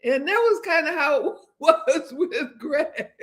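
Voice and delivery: real amused laughter about Greg; laughing. Real, not acted. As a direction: giggle as you recall it. Laughing at the memory.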